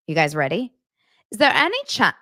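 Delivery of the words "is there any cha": attempted Hackney London accent; a faint stab at his Hackney London accent. Reading the DM to viewers in a faint Hackney accent. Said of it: "I'm gonna try to do it in his accent".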